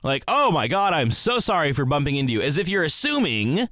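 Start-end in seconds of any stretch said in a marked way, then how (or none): 0.2-2.4 high, gushing fake apology; mock over-apology. Coworker apologizing for a bump, hammed-up and over-sorry. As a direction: exaggerate how terribly sorry you are. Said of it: "like you're telling me that I'm mad at you".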